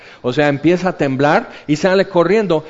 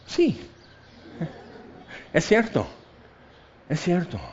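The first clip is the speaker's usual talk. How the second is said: calm concession; 3 semitones higher, 8 dB quieter than usual. Retold or imitated. Conceding calmly to friend.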